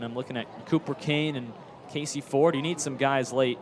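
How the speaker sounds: normal talking voice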